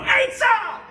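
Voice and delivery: high, loud mock yell; lightly imitated screaming kid. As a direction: play the kids shouting at the TV. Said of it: "kids at home screaming at the T V".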